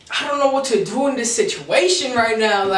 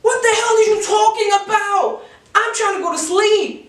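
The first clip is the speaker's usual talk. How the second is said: retold frustrated yell; 10 semitones higher than usual. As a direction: yell it like you've had enough. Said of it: "I looked at her like".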